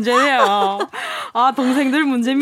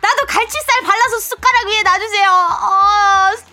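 whiny crying tantrum; 6 semitones higher than usual. Acted. Child tantrum at dinner.

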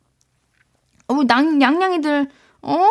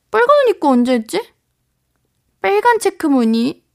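ordinary delivery

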